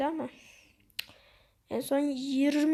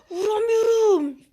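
high, loud, drawn-out wail; frustrated outburst; 8 semitones higher, 8 dB louder, slower than usual. Real, not acted. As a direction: wail it out in exasperation. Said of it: "sinirliyim ya". (sinirle uzatarak bağırma)

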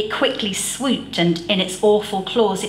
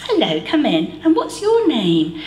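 lady voice; 5 semitones higher than usual. A narrator's reading. Neighbour lady greeting.

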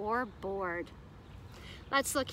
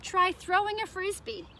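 livelier, higher take; 7 semitones higher than usual. Shown as a demonstration. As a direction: Try throwing a frisbee lifted up high and bright. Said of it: "Here's my little frisbee".

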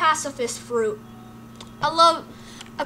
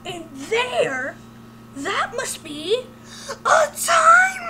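excited realization; 8 semitones higher, much slower than usual. Acted. Flowey realizes time machine.

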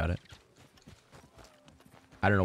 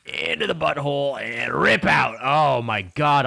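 higher, louder, slow horrified exclaim; real gross-out; disgusted outburst; 8 semitones higher, 8 dB louder, slower than usual. Real, not acted. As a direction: recoil at gross skinning. Disgusted at skinning animation.

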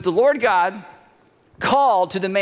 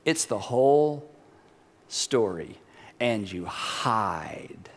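low, very slow and soft; hushed; 10 semitones lower, 6 dB quieter, much slower than usual. Real, not acted. Preacher warns that you start to hide, hushed. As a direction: sink almost to a whisper, drawn out. Preacher's grave point about hiding.

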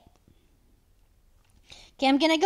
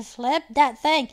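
ordinary delivery

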